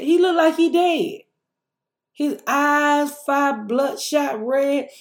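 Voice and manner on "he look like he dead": high, loud worried outburst; high, urgent retold alarm; alarmed protest. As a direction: insist he looks dead.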